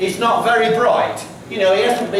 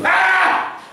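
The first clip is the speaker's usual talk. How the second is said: a sudden very high shriek; mock bride's scream; 13 semitones higher than usual. Storyteller shows children the bride's high scream. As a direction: let out a short piercing "Ah!" for the children. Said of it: "Like that, okay?".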